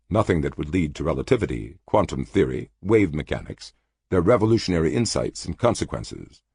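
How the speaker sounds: ordinary delivery, nothing marked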